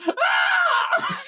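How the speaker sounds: very high wordless shriek; piercing comic goblin cry; lone goblin scream. Acted. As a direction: squeal like one random excited goblin. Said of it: "that one random goblin going".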